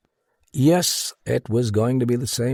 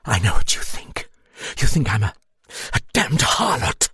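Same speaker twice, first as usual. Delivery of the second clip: bitter; 3 semitones higher, 4 dB louder than usual. A narrator's reading. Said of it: "she said bitterly".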